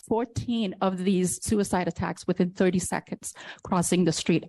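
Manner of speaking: ordinary talk, nothing marked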